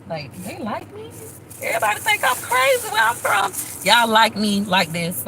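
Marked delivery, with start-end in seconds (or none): 0.1-1.1 much softer, higher, wondering half-whisper; amazed aside. Real, not acted. Marveling that viewers like her, soft disbelieving aside. 1.6-3.5 much higher, squeaky aside; disbelieving squeak. Real, not acted. Telling viewers how people back home see her, high squeaky aside.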